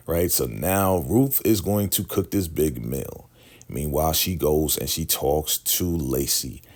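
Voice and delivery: normal talking voice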